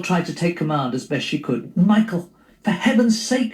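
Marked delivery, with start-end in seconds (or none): 1.8-3.5 higher, pleading voice of the wife. Acted. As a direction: beg him to stop fussing. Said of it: "she pleaded".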